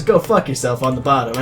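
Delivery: plain reading voice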